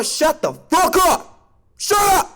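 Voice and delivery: high, slow, exaggerated shut-up; mock shout. Real, not acted. Telling the critics to shut up, high and hammed-up. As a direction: shout them down theatrically.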